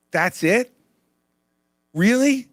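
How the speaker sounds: high, loud disbelieving really; mock disbelief at the state of things; incredulous. Real, not acted. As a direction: sound truly amazed. Incredulous at the world's view.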